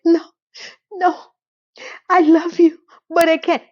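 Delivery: high, halting tearful refusal; mock crying. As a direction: imitate pleading through tears in short bursts. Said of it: "And she starts to cry".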